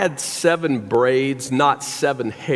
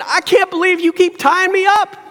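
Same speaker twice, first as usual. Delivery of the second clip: high, louder mock complaint with a laugh; mock indignant Samson; 6 dB louder than usual. Preacher imagining Samson complaining to Delilah, laughing mock outrage. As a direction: play it as a joke, pitched up and loud.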